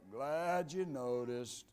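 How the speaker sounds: low, slow, gloomy mumble; mock-mournful drag, deep and soft; Eeyore. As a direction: answer like a heavy, downcast believer. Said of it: "They're the Eeyore believers".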